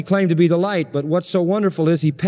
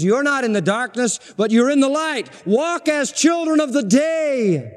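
preaching; 6 semitones higher than usual. Real, not acted. Preacher quoting Paul to the Thessalonians, proclaiming with rising pitch. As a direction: build the verse upward to a ringing close.

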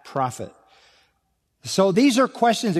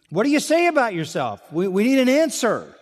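pressing question; 8 semitones higher than usual. Retold or imitated. Delegation pressing John.

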